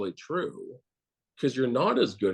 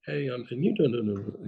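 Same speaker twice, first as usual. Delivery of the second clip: walkie-talkie mimic. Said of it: "they talk to walkie like that".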